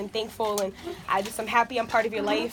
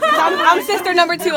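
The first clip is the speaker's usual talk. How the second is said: loud announcement; 8 semitones higher, 12 dB louder than usual. Real, not acted. Announcing herself as next speaker.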